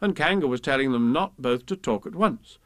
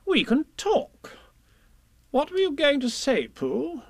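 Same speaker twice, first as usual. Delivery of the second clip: high-pitched Rabbit voice; officious Rabbit; slower than usual. A narrator's reading. Rabbit asking Pooh what he meant, high and officious. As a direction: chair the talk in a high voice. Rabbit takes charge of talk.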